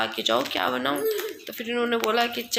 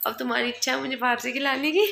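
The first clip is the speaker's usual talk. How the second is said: normal talking voice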